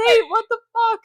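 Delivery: high, loud outraged shriek; hammed-up exasperated shout; shocked yell. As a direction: imitate an appalled mom yelling. Mother's shocked outburst in retold scene.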